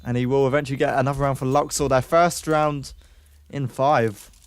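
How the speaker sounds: fast, lively esports commentary; excited caster calling a round; play-by-play casting. Acted. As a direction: call the action as it happens.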